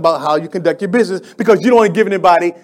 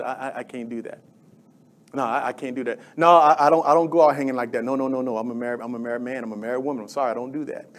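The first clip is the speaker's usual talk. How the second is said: a hint of a meek, shy refusal, softer; timid refusal; 7 semitones lower, 9 dB quieter, faster than usual. Preacher voicing a believer turning down coworkers, lightly and softer. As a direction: mumble the excuse like a shy churchgoer.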